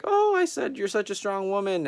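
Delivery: high, falsely bright cover-up voice; mock sweet, trying to smooth it over. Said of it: "trying to save it".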